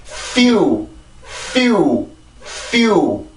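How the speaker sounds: "few" with a careful, airy F; careful F sound. Shown as a demonstration. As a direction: lead "few" with F friction, then the yu glide. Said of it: "F 발음 주의해서요".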